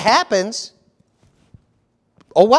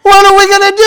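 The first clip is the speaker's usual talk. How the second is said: mock panic; 10 semitones higher, 8 dB louder than usual. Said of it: "this is how Christians are".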